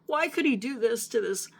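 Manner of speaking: high, pleading cries of why to God; reliving a desperate plea, pitched well up; retold crying plea. Begging God why over a puppy, high anguished plea. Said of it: "You know, and I'm crying".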